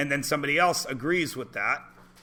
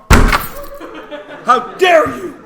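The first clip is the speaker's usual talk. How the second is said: high, slow, hammed-up outrage through a laugh; laughing fake indignation; mock outrage; 11 semitones higher, 11 dB louder, much slower than usual. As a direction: play the offended class, laughing. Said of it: "Number one way to get my class angry".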